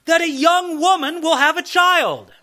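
lightly voiced herald proclaiming a wondrous sign; prophet announcing a sign. Skit prophet answers.

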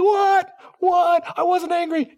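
high, loud squeaky protest; mock indignant child. Child denying anger to parent, squeaky and indignant. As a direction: play a kid caught sulking, outraged. Child denies being angry.

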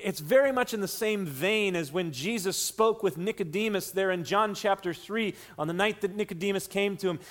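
ordinary delivery

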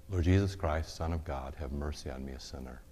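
rhythmic murmured prayer. Shown as a demonstration. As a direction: breathe the words like a heartbeat. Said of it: "say it with every beat of your heart".

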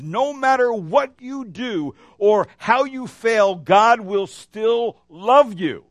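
slow, loud, high preacher's climax; full-force preaching. Acted. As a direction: drive it home from the pulpit, slowly.

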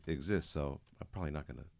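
ordinary talk, nothing marked

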